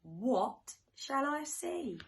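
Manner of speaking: usual speaking voice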